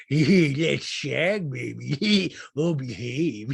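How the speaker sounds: loud, toothy spy-spoof voice; Austin Powers. Retold or imitated. Toothy spy flirting with his catchphrases, broad mock impression. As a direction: ham it up as a randy spoof spy. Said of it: "fucked up teeth like Austin Powers".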